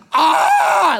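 loud, high yell of pain; retold pain yell. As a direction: cry out as the wound is pressed. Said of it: "I'm like".

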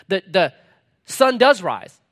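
ordinary talk

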